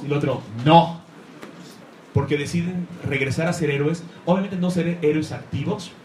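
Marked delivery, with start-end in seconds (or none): 0.6-1.0 a short, much louder no; firm refusal. Retold or imitated. Old hero refusing to retire, a firm loud no. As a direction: slam the door on the idea.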